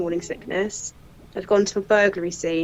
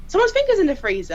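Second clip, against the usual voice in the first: alarmed; 12 semitones higher, 4 dB louder, faster than usual. Retold or imitated. Scene guard overhears, alarmed.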